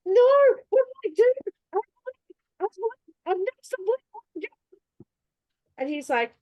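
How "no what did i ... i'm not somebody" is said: high, fast, loud mock cry; mock panic. Pleading with Santa over the naughty list, high fast mock cry. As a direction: wail it out in a rush, hammed-up. Panicked retold reaction again.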